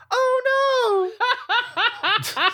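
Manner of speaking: high, laughing mock cry of oh no; hammy oh no. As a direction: overplay a B movie scream of oh no.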